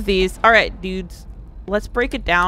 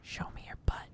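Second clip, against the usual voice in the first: whisper; 22 dB quieter, faster than usual. Acted. Taunting enemy, first quietly.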